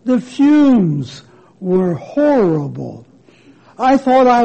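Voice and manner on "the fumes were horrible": ordinary delivery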